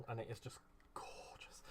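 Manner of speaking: breathless whispered aside. Real, not acted. Crafter admiring the card foiling, in an awed whisper. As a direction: whisper it as if in awe.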